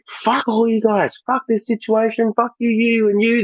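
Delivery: high, slow, furious tirade at the panel; high-pitched slow swearing rant, half retold; retold angry outburst. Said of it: "ended up getting really fucking upset".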